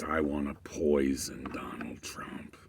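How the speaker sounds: low whispered threat; menacing whisper. Retold or imitated. Guy at the cafe whispering threats, menacing whisper.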